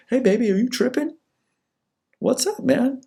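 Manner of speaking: very high, put-on girlfriend voice; puzzled girlfriend. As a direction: imitate a girlfriend fishing for a reply. Acted girlfriend's repeated texts.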